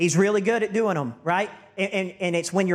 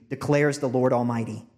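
ordinary delivery

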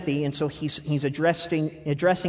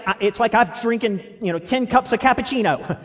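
ordinary delivery

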